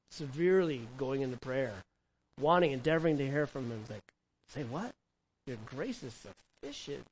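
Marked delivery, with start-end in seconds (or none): 4.5-7.0 hushed, slow, puzzled questioning; quiet incredulity. Acted. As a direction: say it softly, as if doubting what was heard. Acting Paul's puzzled reaction to God.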